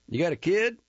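put-on a jittery young rodeo man; Jack, surprised. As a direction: ask it startled and shaky. Jack asks nervously.

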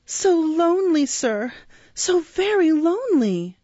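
put-on high, plaintive child's wail; tearful cry. A narrator's reading. Lonely orphan to the beadle, acted tearful cry. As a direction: cry it out as a lonely little boy, pitched high. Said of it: "cried the child".

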